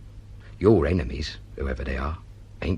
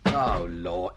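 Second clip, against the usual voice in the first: usual speaking voice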